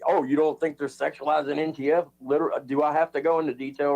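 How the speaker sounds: normal talking voice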